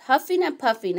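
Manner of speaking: ordinary delivery, nothing marked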